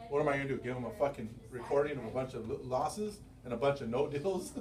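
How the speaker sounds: normal talking voice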